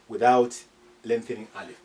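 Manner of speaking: ordinary talk, nothing marked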